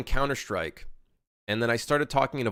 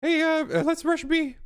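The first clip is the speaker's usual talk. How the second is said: squeaky falsetto gamer; squeaky high voice; 3 dB louder than usual. Pretend. Mock gamer calling a rush in voice chat, squeaky falsetto. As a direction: put on a very high, silly voice.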